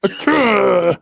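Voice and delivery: high imitated sneeze; fake sneeze. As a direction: mimic a high-pitched sneeze. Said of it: "repeats the sneeze".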